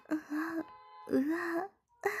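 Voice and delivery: short high moan; performed breathy moaning; acted moans. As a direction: let out brief moans in character. Narrator acts the character's moans.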